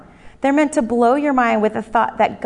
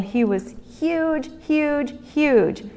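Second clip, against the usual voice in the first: "huge, huge, huge", each a separate beat, pitched up; repetitive emphasis; 6 semitones higher, much slower than usual. Shown as a demonstration. Saw a guy at the ball game, repetitive emphasis on "huge". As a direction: draw out the first "huge", then repeat it with weight. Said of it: "my repetitive emphasis on the word huge".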